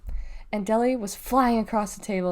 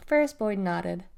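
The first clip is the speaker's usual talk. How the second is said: plain reading voice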